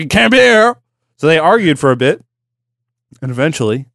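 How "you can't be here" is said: raised, higher-pitched objection; mock insistent officer. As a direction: insist like an exasperated cop. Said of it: "And I was like".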